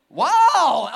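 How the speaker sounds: loud startled wow; surprised wow. Real, not acted. Pastor surprised by the congregation's answer, loud wow. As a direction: sound truly caught off guard. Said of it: "that surprises me".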